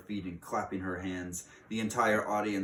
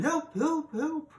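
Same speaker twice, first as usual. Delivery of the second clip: squeaky high mock cry for help; mock yell; 6 dB louder, slower than usual. Witch mimics teachers on desks, high squeaky mock yell. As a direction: shriek like a panicked teacher, hammed-up.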